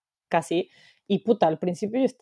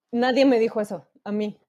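usual speaking voice

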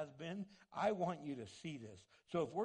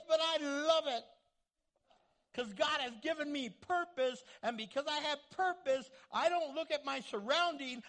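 Paul overjoyed in prison; 7 semitones higher, 5 dB louder than usual. Retold or imitated. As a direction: shout the delight of a chained man who loves his purpose. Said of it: "And he's going".

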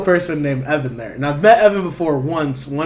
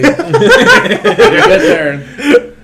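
laughing; 7 dB louder, much slower than usual. Real, not acted. Cracks up starting the story.

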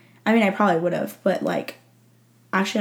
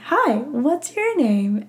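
slow bright high hello to a stranger; imitating a friend greeting her, slow and higher; friendly greeting; 6 semitones higher, much slower than usual. Friend greeting a lonely newcomer, slow and higher.

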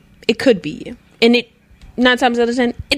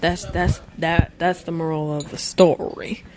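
ordinary talk, nothing marked

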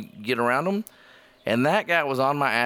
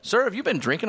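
lightly imitated officer, higher pitch; a touch of official questioning, pitched up; questioning trooper; 7 semitones higher than usual. Trooper asking the driver about drinking, lightly imitated. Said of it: "He goes".

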